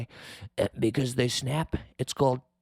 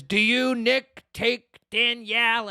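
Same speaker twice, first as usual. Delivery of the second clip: high, slow ceremonial delivery; mock officiating priest; 8 semitones higher, 4 dB louder, slower than usual. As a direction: intone it like a parody wedding priest. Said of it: "the priest says".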